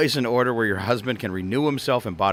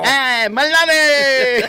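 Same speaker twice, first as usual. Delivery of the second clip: high, loud, slow put-on call; hammed-up hello shouted at the door; mock greeting; 10 semitones higher, 6 dB louder, much slower than usual. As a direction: stretch it out like a cheery welcome. Acting homecoming greeting.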